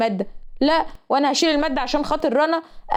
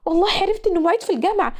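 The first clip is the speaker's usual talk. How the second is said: higher and fast, tired of hearing it; fed-up mocking echo; 6 semitones higher, faster than usual. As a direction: throw back معيد في الجامعة fast and high, sick of the words. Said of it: "والكلمة خرمت طبلة ودني". (بتعيد معيد في الجامعة بسرعة وبصوت عالي بزهق)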